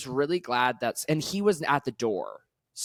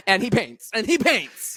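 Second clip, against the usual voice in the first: high, laughing hype-up of a single friend; laughing tease; 6 semitones higher, 5 dB louder than usual. Real, not acted. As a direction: announce it through giggles, much higher. Teasing announcement about single Nick.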